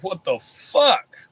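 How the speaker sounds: slow, stunned drawn-out what the fuck; a groggy mock double take; dazed what the fuck. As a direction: wake up bewildered and drag it out. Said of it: "you're like".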